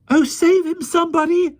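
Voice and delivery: very high, loud desperate cry; anxious cry. A narrator's reading. Jane cries for someone to save the policeman, very high cry. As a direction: beg for help. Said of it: "cried Jane".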